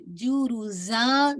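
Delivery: जुरुजा slow, जा held two counts; Quran recitation demo. Shown as a demonstration. Teacher to student, slow stretched जुरुजा. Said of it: "जा टू count करके रोकेंगे".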